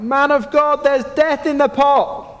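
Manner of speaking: high, loud desperate cry. Retold or imitated. Men crying out to the man of God, loud and high. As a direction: call out in alarm to the prophet. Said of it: "they cried out".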